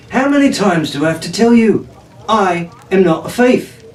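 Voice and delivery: indignant, last words slowed; protesting. A narrator's reading. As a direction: insist you're innocent. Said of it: "Jack protested".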